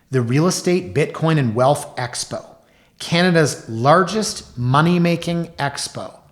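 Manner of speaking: loud, high mock announcer; ad read. Host reading magazine ad for the expo, hyped announcer style. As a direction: hype it up like an expo promo.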